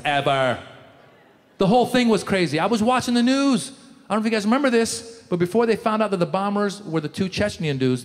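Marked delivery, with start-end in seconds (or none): none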